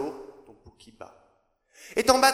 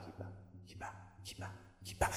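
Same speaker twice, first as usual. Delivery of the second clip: whisper=43; 22 dB quieter, faster than usual. Acted. Whispered refrain in poem.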